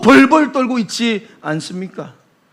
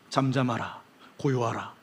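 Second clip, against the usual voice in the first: low, soft, calm rebuke; calm command; 9 semitones lower, 12 dB quieter than usual. Acted. Jesus quietly commanding the wind and waves to be still. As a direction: hush the storm with quiet authority.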